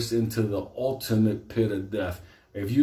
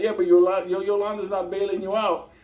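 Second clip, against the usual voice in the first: mock teasing retort; 9 semitones higher, 3 dB louder than usual. Teasing reply to inmate.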